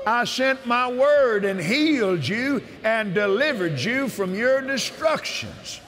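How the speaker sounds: preaching at full force. Acted. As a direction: shout the promise like from the pulpit.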